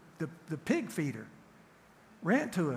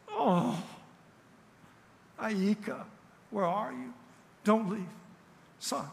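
higher, pleading call for her son; mother's anxious call; 6 semitones higher, slower than usual. Retold or imitated. Pastor retelling his wife calling to their son after surgery, pleading higher. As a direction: reach up in pitch, begging him to stay. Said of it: "he had heard Sonia's".

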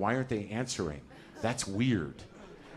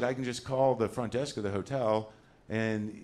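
usual speaking voice

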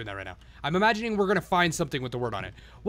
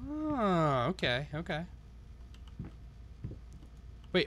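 ordinary delivery

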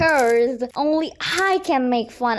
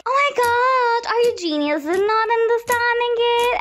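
imitated teacher, high-pitched sarcastic disbelief; mock exasperated teacher; 10 semitones higher than usual. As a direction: scold the student in a raised, hammed-up pitch. Acting out teacher scolding a confused student.

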